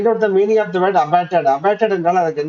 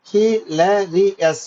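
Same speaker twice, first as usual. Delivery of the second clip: Hilarious very slowly, in four separate beats; syllable by syllable; much slower than usual. Shown as a demonstration. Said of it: "four syllables".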